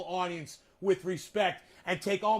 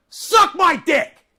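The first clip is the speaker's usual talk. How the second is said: very loud, slow, much higher shout; drawn-out furious yell; screamed taunt; 11 semitones higher, 16 dB louder, much slower than usual. Real, not acted. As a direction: scream it at the freeloaders. Insulting non-sharing viewers.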